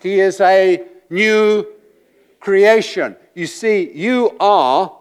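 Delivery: high, loud, impassioned sermon; preacher pours out passion to the congregation; preaching at full force. Acted. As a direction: thunder it from the pulpit.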